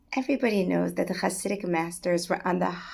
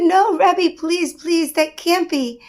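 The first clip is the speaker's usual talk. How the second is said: much higher and louder begging cry; anguished plea; 8 semitones higher, 9 dB louder, slower than usual. Acted. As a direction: refuse to accept it, pleading. Rabbi refuses bad news.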